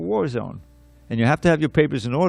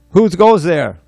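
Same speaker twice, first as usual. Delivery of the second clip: loud, higher mock challenge shout; sentry's challenge; 6 semitones higher, 11 dB louder, slower than usual. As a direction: call out like a guard challenging an intruder.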